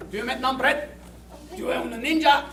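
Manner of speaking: ordinary talk, nothing marked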